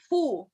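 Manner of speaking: "Phu" as a long aspirated "Poo"; correct Thai pronunciation. Shown as a demonstration. Teacher breaking down the name, "Phu" said as "Poo". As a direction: draw out "Phu" as "Poo", never an F. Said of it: "It's almost like this".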